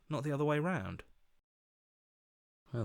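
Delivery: normal talking voice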